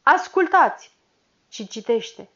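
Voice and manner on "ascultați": comandă tare, mai sus; glas tunător. Acted. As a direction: cere tăcere ca un ofițer. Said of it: "cu glas tunător". (glas tunător)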